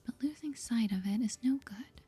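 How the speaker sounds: usual speaking voice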